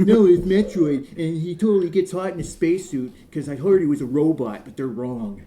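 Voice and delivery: hammed-up correction in a silly voice; mock clueless gamer. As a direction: play a confidently mistaken fan, loudly. Mock know-it-all wrong-fact bit.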